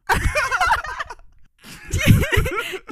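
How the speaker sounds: loud, high burst of laughter; real high-pitched cackling laugh; big laugh. Real, not acted. Co-host cracking up after the story, big laugh.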